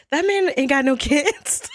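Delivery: high, slow, emphatic disbelief; drawn-out, laughing-edged debunking; incredulous exposing. Retold or imitated. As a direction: imitate a friend calling out a lie. Acts friend exposing the lie.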